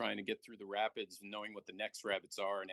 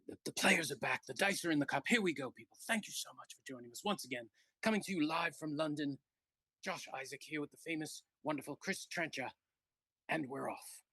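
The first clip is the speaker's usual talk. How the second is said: higher, lively British sportscaster calling the match; British announcer accent; 4 semitones higher than usual. Pretend. Commentator calling the match live from London, in a British accent. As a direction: call the dice like a Wimbledon commentator, British accent. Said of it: "you do a good British accent".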